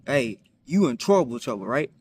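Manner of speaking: normal talking voice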